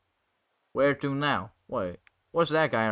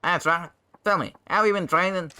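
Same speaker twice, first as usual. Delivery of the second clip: eager rascal; 6 semitones higher, 5 dB louder than usual. Acted. Voices Bacchus quizzing the hero.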